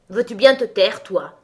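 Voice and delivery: loud, scolding outburst; cross cry. A narrator's reading. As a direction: cry out to silence someone. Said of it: "s'écria le lys tigré". (cri fâché, très fort)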